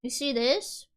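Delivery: ordinary delivery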